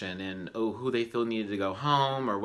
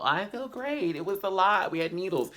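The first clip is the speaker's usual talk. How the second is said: high-pitched, hammed-up cheery answer; lightly mimicked contestant; 8 semitones higher than usual. Contestant answering castmates on how they feel, lightly mimicked. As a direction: lift the voice high for a mock contestant.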